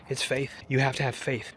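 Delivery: ordinary delivery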